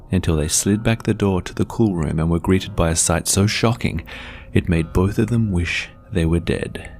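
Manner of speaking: usual speaking voice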